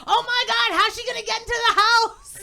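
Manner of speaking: put-on high, loud panicked child; mock freak-out. Comedian playing son hearing of tooth fairy, mock panic. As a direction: imitate a kid alarmed by an intruder. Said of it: "or else they'll freak out".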